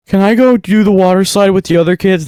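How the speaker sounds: put-on high pleading little-kid voice; child voice. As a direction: ask like a small kid begging to leave the lesson. Child voice asking instructor.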